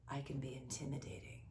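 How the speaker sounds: low, hushed whisper into the camera; mock menacing whisper. Teasing the viewer, a mock spooky whisper. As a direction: whisper it like a playful threat. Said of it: "I'm gonna look at the camera when I say this".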